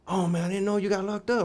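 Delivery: high put-on voice, surprised; imitating the friend. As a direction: sound shocked to find him locked up. Friend surprised he's locked up.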